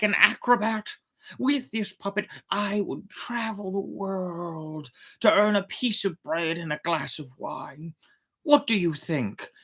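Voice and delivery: put-on old man voice. Geppetto explains his idea.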